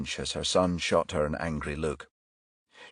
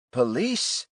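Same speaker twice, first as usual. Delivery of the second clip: hesitant; 7 semitones higher than usual. A narrator's reading. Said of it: "she said hesitantly".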